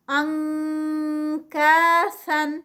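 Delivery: انکاسن slow with the نون as an nk sound; nk recitation. Shown as a demonstration. Teacher demonstrates the ink sound slowly. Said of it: "آئی این کے کی دیں گے".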